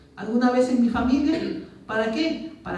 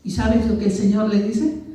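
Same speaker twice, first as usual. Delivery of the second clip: ordinary talk, nothing marked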